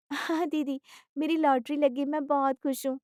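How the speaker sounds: innocently pleased daughter-in-law; happy daughter-in-law. Acted. Daughter-in-law happily confirms her win.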